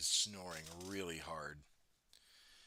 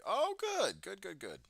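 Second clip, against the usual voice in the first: squeaky cheer; 11 semitones higher, 4 dB louder, slower than usual. Pretend. Cheering a small win over software.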